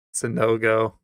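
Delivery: ordinary delivery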